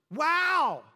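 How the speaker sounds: high, loud gasp of amazement; astonished wow. Acted. Townspeople amazed at Jesus, loud and high wow. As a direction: townsfolk gasping in disbelief. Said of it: "the people were astonished".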